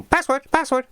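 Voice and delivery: high-pitched, silly repeated demand; comically high wrong take; mock squawky voice. Shown as a demonstration. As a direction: say it high and daft, twice over. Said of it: "It doesn't go".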